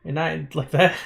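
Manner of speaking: ordinary talk, nothing marked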